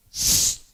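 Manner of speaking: hushing hiss, "shh"; sharp shush. Acted. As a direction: shush someone with a long hiss. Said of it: "Cuando le dijeron".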